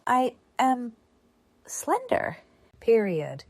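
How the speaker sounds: pace it for students writing; dictating. Shown as a demonstration. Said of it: "I repeat".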